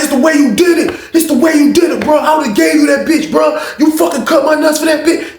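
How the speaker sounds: high, loud betrayed yelling; furious shout. Retold or imitated.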